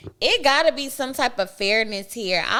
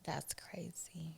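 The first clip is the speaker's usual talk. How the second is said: soft breathy whisper; 3 semitones lower, 25 dB quieter, much slower than usual. Real, not acted. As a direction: whisper it under your breath. Whispered reaction.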